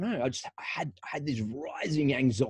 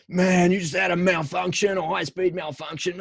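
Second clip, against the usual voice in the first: higher, urgent mate breaking the news; mate's alarmed voice; 7 semitones higher than usual. Retold or imitated. Mate Paul telling him he had a malfunction, raised alarmed voice. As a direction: blurt it out like a worried mate. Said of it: "he's like".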